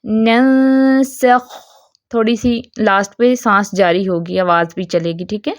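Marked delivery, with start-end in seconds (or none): 0.0-1.5 ننسخ with the final خ held long and breathy, very slow; sustained kh. Shown as a demonstration. Quran teacher showing students how to say ننسخ, slow drawn kh. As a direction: stretch the خ in ننسخ, letting breath flow. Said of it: "تو کیسے ادائیگی کرنی ہے".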